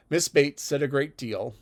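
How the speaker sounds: ordinary delivery, nothing marked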